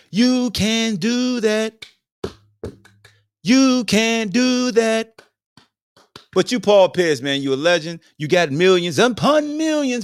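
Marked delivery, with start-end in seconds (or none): 0.1-5.1 high and slow, drawn out; slow mock approval. Telling the Disney host slowly, mock approving. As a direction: stretch every word like a teasing blessing.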